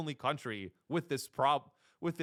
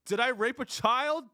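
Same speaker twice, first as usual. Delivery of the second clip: higher, louder mock confession; 7 semitones higher, 5 dB louder than usual. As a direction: blurt it out like a shameless leader. Mock confession voicing leaders.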